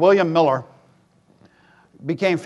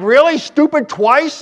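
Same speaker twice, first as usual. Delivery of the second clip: incredulous scoff; 8 semitones higher, 4 dB louder, slower than usual. Retold or imitated. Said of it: "I'm like".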